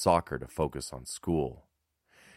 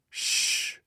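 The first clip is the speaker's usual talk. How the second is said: hushing shh. Acted. Man hushes the boy.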